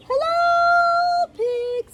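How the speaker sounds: very high, loud duck greeting; duck character. A narrator's reading. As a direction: stretch the greeting out in a squeaky duck voice. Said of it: "said Duck".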